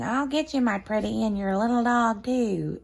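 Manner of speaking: a witch threatening the little dog; Wicked Witch. Retold or imitated. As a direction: menace like a storybook witch. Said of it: "witch, when she says".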